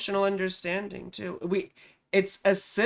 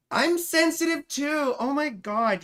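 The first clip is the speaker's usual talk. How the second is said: whiny protest; 8 semitones higher than usual. Real, not acted. Telling chat she is sensitive too, a high mock-hurt whine. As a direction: play the wounded one.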